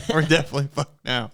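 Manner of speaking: usual speaking voice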